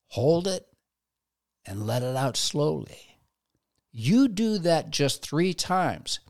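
slow, calm breathing guide. Acted. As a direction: talk them down gently, slowly. Leads a breathing exercise.

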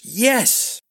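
high, exasperated outburst. Acted. Prince fed up with his forgetful master, exasperated. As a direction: snap out the confirmation.

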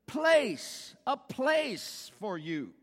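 "Place" hit high and loud; emphasized word. Shown as a demonstration. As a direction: punch "Place", then slow down. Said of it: "what's the word?".

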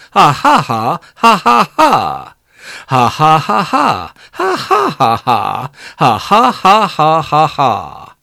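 long, hammed-up "ha ha" laughing; villainous laugh. Baby Henry goes on laughing at Arthur, mock-villainous. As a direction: keep the acted cackle coming in bursts. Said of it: "he laughed".

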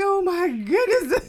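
high, slow amazed cry. Retold or imitated. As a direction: gasp it out, overwhelmed. Retelling her amazed reaction.

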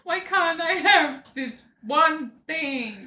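high, slow laughing whine; mock whine. Friend mocking the sisters' complaints with a laughing whine.

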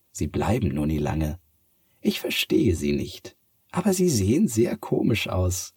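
a cat puzzled by people. Acted. Cat talks about humans. (hohe Katzenstimme)

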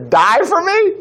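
high-pitched disbelieving question; acted-out sceptical retort; mock incredulity. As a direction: echo the boast back, pitch shooting up. Acting out Jesus questioning Peter's boast.

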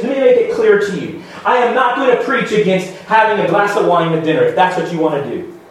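normal talking voice

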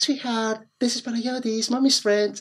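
put-on high, sweet baby talk. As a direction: coo it high to a newborn. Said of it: "λέει".

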